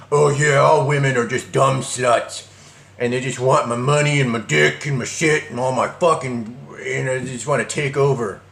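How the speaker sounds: put-on voice of a ranting man; mock bitter misogynist. Man he avoids sounding like rants about women, mock put-on voice. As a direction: caricature a guy griping about women. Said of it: "come off as, like, a guy".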